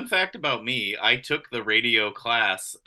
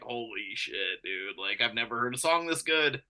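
hushed awe; 7 dB quieter than usual. Retold or imitated. Fan recalls first hearing [name]'s single, hushed awe. Acted awed reaction to new song.

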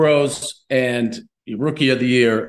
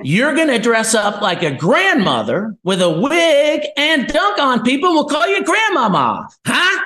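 hammed-up ad executive, climbing higher to the punchline; mock ad man pitch; 11 semitones higher than usual. Ad agency pitching the Grandmama idea to a rookie, hammed-up and rising. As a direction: pitch it like a hyped marketing man.

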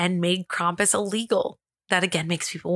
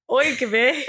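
higher, mock-Irish exclamation; Irish accent; 4 semitones higher than usual. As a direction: put on a silly Irish accent. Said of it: "doing an Irish accent with French words".

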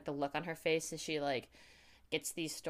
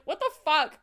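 higher, louder disbelief; shocked outburst; 10 semitones higher, 8 dB louder than usual. Retold or imitated. Reacting to Eddie's jab with a higher, louder disbelieving outburst. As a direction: blurt it out stunned. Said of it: "it's like".

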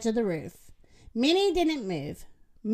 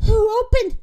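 high, loud startled question; gasped question; 9 semitones higher, 7 dB louder than usual. A narrator's reading. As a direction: gasp it out in surprise. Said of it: "Minnie gasped".

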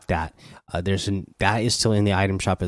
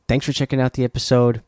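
usual speaking voice

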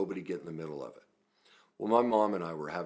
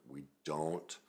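ordinary talk, nothing marked

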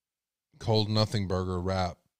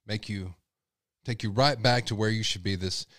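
ordinary talk, nothing marked

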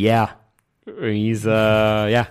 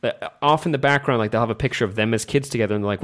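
usual speaking voice

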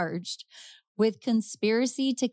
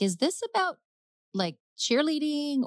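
high-pitched, puzzled questioning; shocked confusion; 9 semitones higher than usual. Acted. Shocked mother asking detectives why they came, bewildered. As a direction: voice jumps up in bewildered disbelief. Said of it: "completely shocked when these detectives show up".

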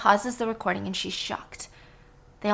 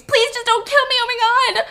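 much higher, loud pleading shriek; terrified scream; 13 semitones higher, 6 dB louder than usual. Acted. Whiskey screaming in terror for her life in the dark. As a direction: beg for your life in a scream. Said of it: "Whiskey screams".

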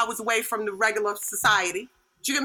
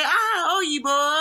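mock exclamation, pitched up; 3 semitones higher, slower than usual. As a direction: call it out high, drawn out.

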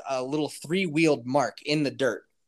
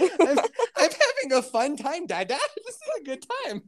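put-on very high, giggly toddler voice; Baby Yeti; 12 semitones higher than usual. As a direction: squeal it like a delighted tiny child. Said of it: "Baby Yeti's just like".

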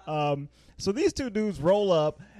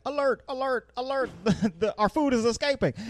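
retold alarm; 8 semitones higher than usual. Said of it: "the native sees them and is like".

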